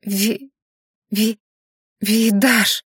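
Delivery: halting stammer, broken by pauses; squeezed out. A narrator's reading. Rena, shocked to see her husband, squeezes out his name. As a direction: force the name out bit by bit. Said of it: "выдавила из себя Рена".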